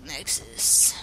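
low, slow whisper; whispered warning. Acted. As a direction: hiss a secret warning.

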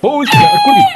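enraged roar demanding she confess; furious shout. Acted. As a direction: scream it high and loud, a raging husband mid-beating. Husband beats wife, demanding answer.

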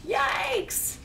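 high-pitched storybook yelp; startled scream. A narrator's reading. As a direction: act out the boy's startled scream. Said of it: "the boy screamed".

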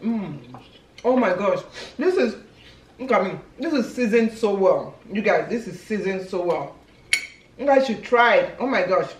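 chewing while talking; speaking between bites; mouth full. Real, not acted. Seen 1: Woman holds gizzard skewer, just ate; mouth looks full while talking.

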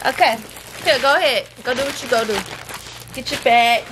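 ordinary delivery

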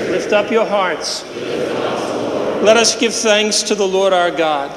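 ordinary delivery

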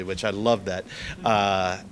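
ordinary talk

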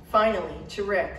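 usual speaking voice

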